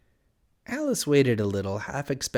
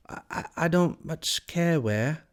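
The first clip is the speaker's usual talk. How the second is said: lightly voiced higher, stammering Alice; a touch of hesitation, pitched higher; hesitant Alice; 6 semitones higher than usual. A narrator's reading. Alice replying to the Cat, mildly hesitant and higher.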